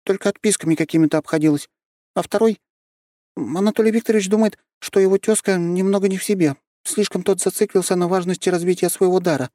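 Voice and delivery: lightly voiced young girl; high girl voice. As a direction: speak up high like a small girl. Girl voice continues.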